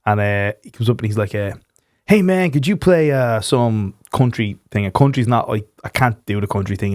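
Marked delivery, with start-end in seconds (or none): 2.1-4.9 a light American twang, slow and drawn out; American tourist. Retold or imitated. Imitating a pub Yankee asking for country music, in a light American accent. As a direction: lightly ham up a drunk American tourist's request. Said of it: "this fucking Yankee come up to me".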